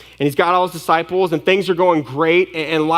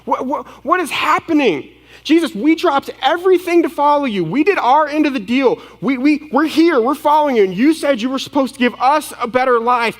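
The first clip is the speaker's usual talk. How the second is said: high-pitched, panicky protest to Jesus; frightened, exasperated pleading, pitched way up; acted frantic frustration; 9 semitones higher than usual. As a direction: play a freaked-out disciple demanding answers. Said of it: "hear the fear and the frustration in their voices".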